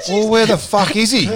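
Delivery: incredulous outburst in a retelling; retold alarm. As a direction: act out an exasperated shout. Retold alarmed reply to calm news.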